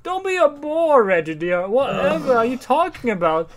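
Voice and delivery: mock posh wife; snooty society wife. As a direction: play a haughty socialite, hammed up. Voicing rich wife from comic.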